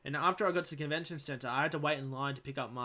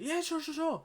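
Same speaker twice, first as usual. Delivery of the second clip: much higher, chirpy yeah sure sure; friendly cosplayer. Retold or imitated. Cosplayer agrees to a fan's photo, imitated very high and chirpy. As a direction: imitate a cheery famous cosplayer.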